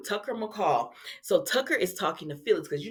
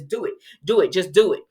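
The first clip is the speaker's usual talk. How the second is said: punchy, louder by the end; insistent urging; 7 dB louder than usual. Retold or imitated. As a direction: imitate someone pushing hard for it. Retold son urging mother on.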